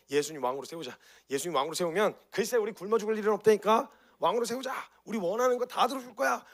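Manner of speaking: loud, high, eager mob calling out; rally the crowd to crown him; excited crowd voice. Acted. Crowd urging each other, an eager loud cheer. Crowd wants Jesus as king.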